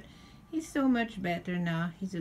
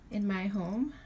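usual speaking voice

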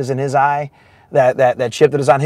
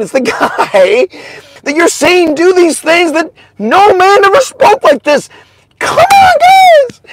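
much higher and louder, shouting with joy; preaching at full force; 10 semitones higher, 6 dB louder than usual. Acted. Preacher hypes congregation about Jesus in the storm, shouting. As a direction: preach it like the roof is coming off.

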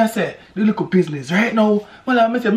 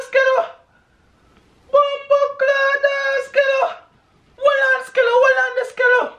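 hyped reaction; 11 semitones higher than usual. Real, not acted. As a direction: shout it out, thrilled by the track. Hyped reaction, replaying the part.

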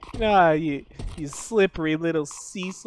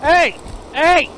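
louder, higher barked Heys; furious yelling; 6 semitones higher, 5 dB louder than usual. Acted. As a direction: shout after the fleeing player. Yelling after a fleeing player.

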